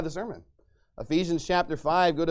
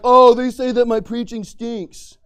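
mocking whine; 4 semitones higher, 10 dB louder, slower than usual. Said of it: "And he's like".

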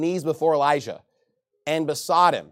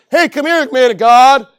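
much higher, loud taunting Hey, come here; mocking; 8 semitones higher, 14 dB louder than usual. Captain taunts Elijah, high loud mocking call. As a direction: sneer it like a rude call. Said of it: "He's saying, like".

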